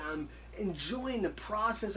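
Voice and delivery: usual speaking voice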